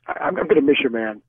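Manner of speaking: usual speaking voice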